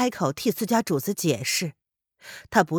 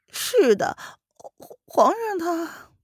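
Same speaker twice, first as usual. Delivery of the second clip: stammering; 11 semitones higher, slower than usual. Acted. Servant stammers before mistress.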